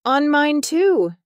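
high, loud storybook shout. A narrator's reading. Leopard thinks the sky fell on him, shouting. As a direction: call it out high, in a narrator's shout. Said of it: "shouted the leopard".

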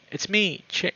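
tentative high-pitched call; cautious second try. A narrator's reading. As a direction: call out who you are, breaking off mid-name. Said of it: "I try again".

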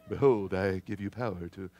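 a light, quiet put-on English accent for a film Jesus; mock English accent. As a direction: mimic a Hollywood Jesus in an English accent, softly. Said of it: "think English accent".

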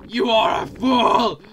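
loud, slow theatrical shout; drawn-out playful reprimand; mock scolding. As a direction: bellow it slowly, hammed up.

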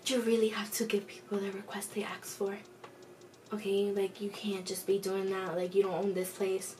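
soft, half-whispered imitation of a scolding manager; hushed reprimand. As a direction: act out a quiet telling-off. Acted manager scolding the teller.

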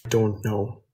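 usual speaking voice

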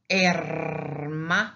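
hermano with a long trilled r before the m; doubled R. Shown as a demonstration. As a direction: roll the r long in hermano, slowly. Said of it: "it becomes double".

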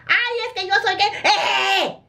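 high, squealing mock celebrity voice; a goat-like bleating laugh, hammed up. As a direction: ham up a silly showbiz squeal with a laugh. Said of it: "porque te reís muy feo".

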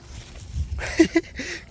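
high, soft giggle. Real, not acted. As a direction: let out a quiet high-pitched giggle. Laughing at the steep drop. (mataas at mahinang hagikgik)

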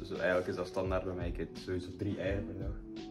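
normal talking voice

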